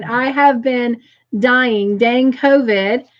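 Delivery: ordinary delivery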